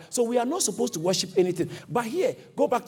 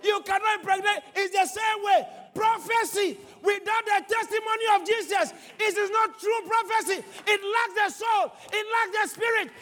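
preaching at full force; 13 semitones higher than usual. Real, not acted. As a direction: build to the climax, ringing.

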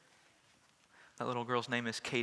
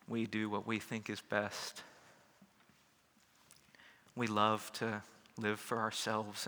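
prayer; 2 semitones lower than usual. Acted. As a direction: pray it humbly to the Father. Pastor leads closing prayer.

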